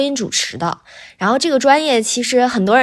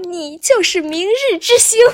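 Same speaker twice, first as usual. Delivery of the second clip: a mock-gushing teacher, pitched much higher; 10 semitones higher than usual. Retelling the teacher calling her a rising star, with mock gushing. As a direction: lightly play the teacher heaping praise on her. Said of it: "他就说".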